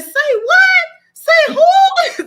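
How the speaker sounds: imitating a stunned aunt's outcry; shocked shriek. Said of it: "She said".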